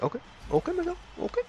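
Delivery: high-pitched mock agreement; imitated friend agreeing. Voices friend reluctantly agreeing.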